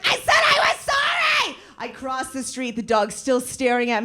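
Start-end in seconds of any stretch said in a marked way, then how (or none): 0.1-1.6 very high, loud, slow yell; exasperated shout. Retold or imitated. Fleeing the staring dog, high exasperated yell. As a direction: yell an apology in despair. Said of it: "I was like".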